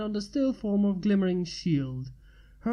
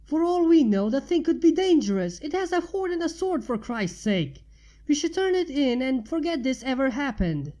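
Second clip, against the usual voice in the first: higher, louder angry shout; shouting back; 6 semitones higher, 4 dB louder than usual. A narrator's reading. Heated row continues.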